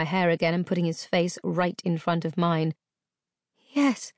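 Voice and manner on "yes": high, small, shaken yes; shaky reply. A narrator's reading. Girl answering her captor in fear. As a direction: barely get it out. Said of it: "I replied shakily".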